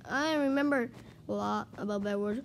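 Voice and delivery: ordinary delivery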